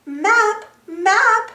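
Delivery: high, loud summoning call. A narrator's reading. Two friends summon Map from the backpack, calling out. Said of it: "they called".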